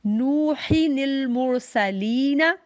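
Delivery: नूहिनिल मुरसलीना drawn out very slowly, nun joined; slow Quranic recitation. Shown as a demonstration. Teacher demonstrates the linked reading for her students. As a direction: chant नूहिनिल मुरसलीना in one slow breath. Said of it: "लेकिन हमने reading कैसे करनी है".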